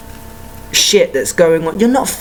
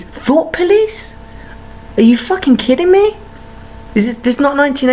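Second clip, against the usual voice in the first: incredulous outburst; 9 semitones higher than usual. Real, not acted. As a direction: scoff in disbelief, voice shooting up. Outraged at idea of thought police.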